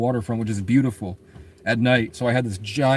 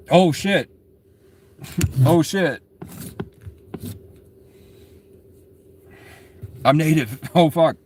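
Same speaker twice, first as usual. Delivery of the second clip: laughing realization; 4 semitones higher, slower than usual. Retold or imitated. Realizing the joke was true.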